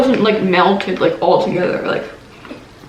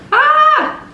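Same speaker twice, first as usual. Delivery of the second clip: high playful squeal. Real, not acted. Playful yelp at end.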